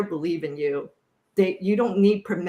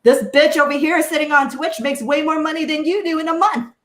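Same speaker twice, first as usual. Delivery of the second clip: retorting; 6 semitones higher, 5 dB louder than usual. Retold or imitated. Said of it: "And I'm like".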